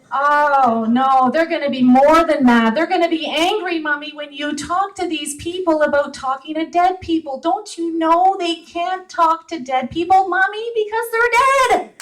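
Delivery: put-on a seven-year-old lecturing his mother; child's voice. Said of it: "he says".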